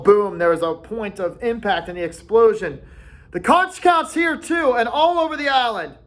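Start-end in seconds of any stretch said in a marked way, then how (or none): 3.3-6.0 higher, firmly insisting; high insistent boy. A narrator's reading. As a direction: insist it counts. Ralph insists.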